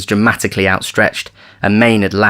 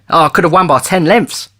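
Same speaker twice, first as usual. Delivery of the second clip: bright, raised boast; boastful jockey; 9 semitones higher, 4 dB louder than usual. Acted. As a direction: brag about the win.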